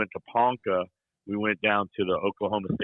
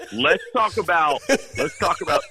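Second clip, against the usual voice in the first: higher-pitched, laughing attempt to restart; laughing through words; 7 semitones higher than usual. Real, not acted. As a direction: try to move on while cracking up. Trying to resume while cracking up.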